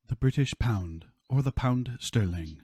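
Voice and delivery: put-on grave BBC British accent, slow on the pound; posh British accent. Mock BBC bulletin naming the pound in a British accent. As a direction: intone it like a British newsreader. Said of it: "Very posh".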